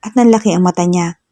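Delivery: plain reading voice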